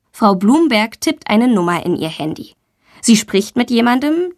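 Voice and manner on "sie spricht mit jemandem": usual speaking voice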